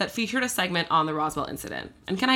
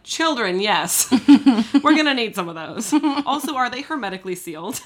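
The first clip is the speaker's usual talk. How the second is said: quoting through laughter; laughing imitation; 5 semitones higher, 5 dB louder than usual. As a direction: voice the officer while cracking up. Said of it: "And they were like".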